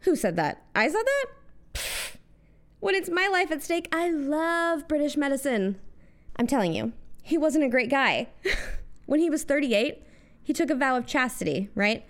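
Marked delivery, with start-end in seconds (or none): 0.0-5.8 mock Gandhi backpedaling. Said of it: "And Gandhi was like".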